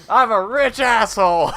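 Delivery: loud, high, boastful comeback; gloating shout. Retold or imitated. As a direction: crow it proudly at your victim. Gloating retort to the victim.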